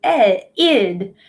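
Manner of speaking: "e-, id" broken into its two parts; a slow teacher demo of the "id" ending; sounding out id. Shown as a demonstration. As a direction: stretch "e-" then land on "id". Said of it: "E D is going to say".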